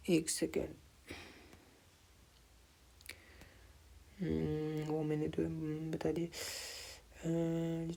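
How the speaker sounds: very soft, under-the-breath mumble; muttering to self. Real, not acted. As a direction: talk quietly to yourself while checking something. Mutters to himself while finding place. (دھیمی آواز میں خود سے بڑبڑانا)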